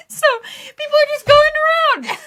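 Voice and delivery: very high, loud, breaking into laughter; laughing shout. Real, not acted. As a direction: shout it through helpless laughter at your own story. Laughing as she retells the chaos.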